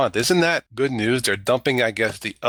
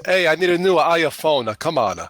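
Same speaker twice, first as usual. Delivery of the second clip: high, loud mock-Italian guy; hammed-up Italian accent, pitched up; 6 semitones higher, 3 dB louder than usual. Podcast host imitating an Italian guy switching to iPhone, comic Italian accent. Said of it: "one Italian guy says".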